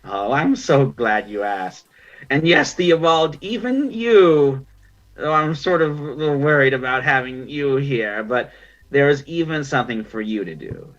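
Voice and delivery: lightly voiced ancient otter crone, pleased and a bit wary; gracious old pirate queen with a hint of doubt. GM voicing Mother Maya.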